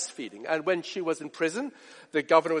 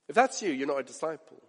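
ordinary talk, nothing marked

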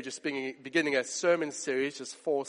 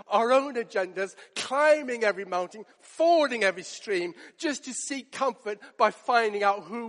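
raised, urgent sermon voice; fervent preaching; 5 semitones higher, 4 dB louder than usual. Acted. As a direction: press the urgency on the congregation. Preaching Paul's letter.

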